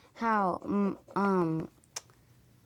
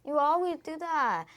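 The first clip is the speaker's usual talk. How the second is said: complaining; 10 semitones higher than usual. Real, not acted. Daughter complains to mother.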